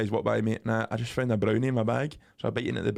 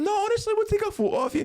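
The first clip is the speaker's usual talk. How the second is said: squeaky insistent stranger, much higher; high pitched imitation of an eager passerby; high mock stranger voice; faster than usual.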